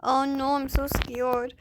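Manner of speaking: normal talking voice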